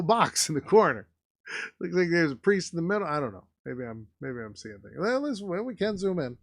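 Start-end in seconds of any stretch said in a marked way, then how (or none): none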